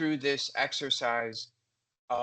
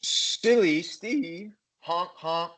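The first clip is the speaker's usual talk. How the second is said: hammed-up silliness; silly; 3 semitones higher, 6 dB louder, slower than usual. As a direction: call it out goofily and toot a horn. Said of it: "passing the emotion that goes to it".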